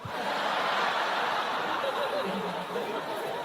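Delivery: high breathy laugh. Real, not acted.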